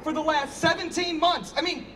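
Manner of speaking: high, pressed exasperation; pushing back hard on their claims; rising indignant denial; exasperated protest. Acted. Arguing with Robert and daughter.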